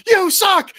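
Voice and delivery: high, loud punchline shout; yelled heckle. Retold or imitated. Friend heckling Manny in right field, high yell. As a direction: yell the payoff at the player. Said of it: "And then he just yells".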